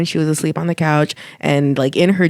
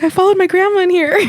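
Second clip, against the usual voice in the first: retold high quote; 4 dB louder than usual. Mom answering dad, retold.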